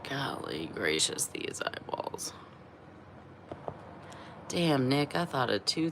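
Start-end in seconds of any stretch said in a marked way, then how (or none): 0.1-2.3 real unvoiced whisper, much lower and softer. Real, not acted. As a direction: breathe it out to yourself. Muttering to herself about eyes.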